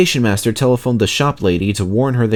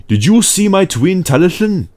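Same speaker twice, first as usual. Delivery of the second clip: much higher, louder engine voice; Skarloey; 10 semitones higher, 5 dB louder than usual. A narrator's reading. Skarloey asking Sir Handel, high and loud. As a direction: chirp it out as a different little engine. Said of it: "asked Skarloey".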